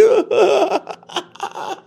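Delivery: high cackling laugh breaking out of the fake cry; burst of laughter. Coworker drops the act and bursts out laughing. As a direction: crack up hard. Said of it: "He starts dying laughing".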